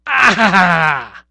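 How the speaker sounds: loud high yelp as nose comes off; pained yelp. Acted. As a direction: cry out at the sting of the glue. Rips the nose off.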